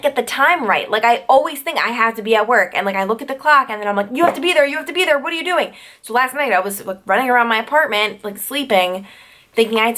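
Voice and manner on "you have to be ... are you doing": high-pitched urgent self-scolding; mock panic. Waking up thinking she's late for work, high mock panic.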